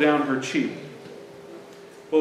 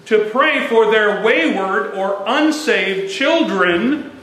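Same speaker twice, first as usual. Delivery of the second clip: usual speaking voice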